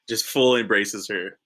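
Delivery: usual speaking voice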